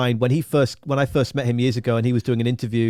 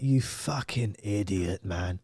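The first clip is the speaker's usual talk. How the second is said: muttered self-reproach; 4 semitones lower, 8 dB quieter, much slower than usual. Retold or imitated. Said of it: "I was like".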